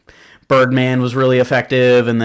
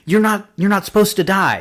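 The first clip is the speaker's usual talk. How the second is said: high, mildly panicked pleading without extra volume; high-pitched mock panic; retold freak-out; 9 semitones higher than usual. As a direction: imitate someone starting to freak out, pitched up high. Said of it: "he starts freaking out".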